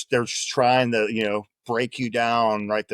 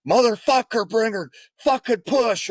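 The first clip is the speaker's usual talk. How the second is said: much higher, louder mock rage; furious yell; 11 semitones higher, 3 dB louder than usual. Buddy kicking the crying recruit, furious yell. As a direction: scream at the slacker to push.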